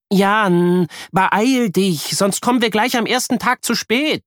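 urging her son to hurry; busy mother calling out. A narrator's reading. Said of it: "ruft sie ihm zu".

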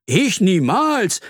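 high, slow, emphatic denial; indignant denial. Acted. Earthworm denies being afraid, indignant. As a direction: stretch it out with bravado.

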